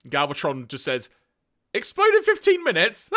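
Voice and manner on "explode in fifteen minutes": loud, high, incredulous scoff breaking into a villain laugh; hammed-up villain ridicule, pitched way up; mocking disbelief. Galvatron laughing off Zarak's warning, mocking and incredulous.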